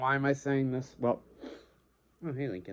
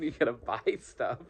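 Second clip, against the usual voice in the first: normal talking voice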